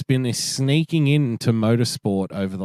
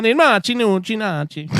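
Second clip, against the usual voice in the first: mock Italian; 10 semitones higher than usual. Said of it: "mocking Italian-sounding gibberish".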